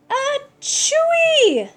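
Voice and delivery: high, loud, exaggerated sneeze; big sneeze. Kitten sneezing from the red spice, loud and high. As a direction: let the spice explode out of the nose. Spice tickles his nose.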